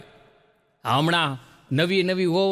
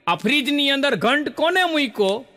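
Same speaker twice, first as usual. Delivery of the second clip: higher mock yell; retold yell; 7 semitones higher than usual. Mother-in-law finds a bell in the fridge, mock yell. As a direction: call out the question like a startled mother-in-law. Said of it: "સીધી રાડ પાડી".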